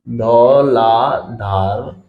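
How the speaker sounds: slow low dictation; syllable by syllable. Shown as a demonstration. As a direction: stretch it out as if dictating while writing. Teacher spells out name while writing.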